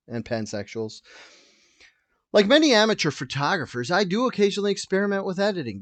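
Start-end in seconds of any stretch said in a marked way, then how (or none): 2.3-5.8 higher put-on voice reading a statement; half-hearted princess impression, pitched up; Kate Middleton. Host reading Kate's apology, higher put-on voice. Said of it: "This is Kate Middleton again".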